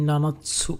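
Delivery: নানাৎসু said as na-na-tsu, with a crisp tsu; Japanese nanatsu. Shown as a demonstration. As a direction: model নানাৎসু for the class. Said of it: "সেটা উচ্চারণ হবে এরকম". (নানাৎসু স্পষ্ট জাপানি উচ্চারণে)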